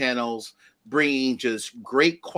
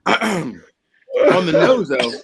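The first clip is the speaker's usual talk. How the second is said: strained; 4 semitones higher, 7 dB louder, much slower than usual. Real, not acted. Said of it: "my eyes are watering".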